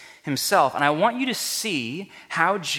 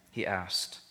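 ordinary talk, nothing marked

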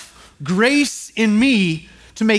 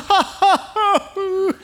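high, forced mock laugh of a boy; imitated child laugh; 11 semitones higher, much slower than usual. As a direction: do the kid's nervous little laugh. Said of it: "the laugh goes from".

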